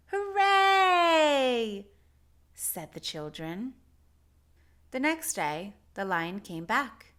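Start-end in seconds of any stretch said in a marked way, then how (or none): 0.1-1.9 cheer. A narrator's reading. Children cheering.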